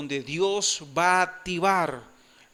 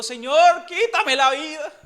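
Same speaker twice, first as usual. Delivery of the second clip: loud, high despairing cry; hammed-up wail of despair; Moses despairing; 7 semitones higher, 7 dB louder than usual. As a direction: cry out like Moses at his limit. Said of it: "Moisés dijo".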